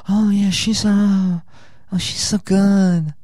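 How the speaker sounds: high, slow gushing date voice; gushing girl. Retold or imitated. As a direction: gush high about the singer.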